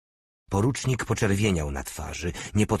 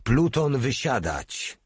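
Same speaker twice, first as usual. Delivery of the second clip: high-pitched military order; drawn-out sergeant's shout; shouted command; 6 semitones higher, slower than usual. A narrator's reading. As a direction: call out a drill order. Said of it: "Paust krzyknął".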